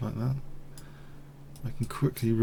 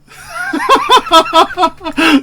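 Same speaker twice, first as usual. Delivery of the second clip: loud high laugh; 12 dB louder than usual. Real, not acted. Streamer bursting into loud laughter over the board. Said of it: "I don't know why I'm laughing".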